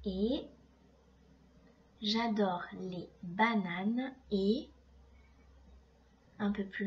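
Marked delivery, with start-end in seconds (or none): 0.1-4.7 slow, careful reread. Shown as a demonstration. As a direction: read it back slowly for the pupils. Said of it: "on va écrire le petit mot et".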